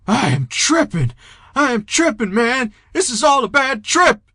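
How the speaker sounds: loud, high-pitched freak-out; high, manic panic. A narrator's reading. Jeter backing up on a bad trip, manic panic. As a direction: cry out in frantic disbelief, voice way up. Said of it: "his voice high and manic".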